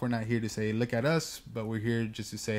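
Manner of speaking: ordinary delivery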